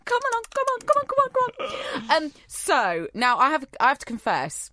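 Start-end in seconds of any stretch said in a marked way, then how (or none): 0.0-2.2 high, rapid coaxing calls; mock coaxing. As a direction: coax like calling a pet over, high-pitched. Acts luring someone off tracks.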